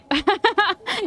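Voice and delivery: high, jeering cackle; mocking bird laugh. Little bird laughing at the struggling player, mocking. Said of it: "Little, little bird's like".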